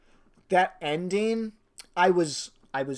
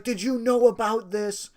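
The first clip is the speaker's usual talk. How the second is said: angry accusation; 7 semitones higher, 4 dB louder than usual. Retold or imitated. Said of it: "And he's like".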